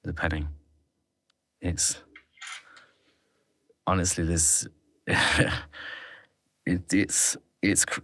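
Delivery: halting, broken starts with long pauses; real stammering hesitation, words left unfinished; stuttering. Real, not acted. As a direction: struggle to get the words out. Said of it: "the reason I'm stuttering here".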